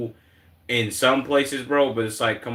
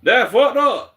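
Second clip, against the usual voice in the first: shocked reaction; 12 semitones higher, 7 dB louder, slower than usual. Real, not acted. Reacting to clip.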